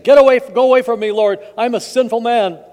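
loud, high, urgent outcry; desperate plea. Acted. Simon falls at Jesus' knees, pleading loudly. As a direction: cry out as an unworthy sinner.

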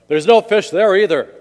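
voice from above. Acted. Voice from above warns ice fisher, calling loudly. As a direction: call it down from the heavens. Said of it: "he hears the voice again from above".

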